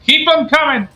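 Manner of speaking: high, loud bar order; rowdy shout for another round; calling out. Acted. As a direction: holler it across a busy tavern. Player calls out drink order.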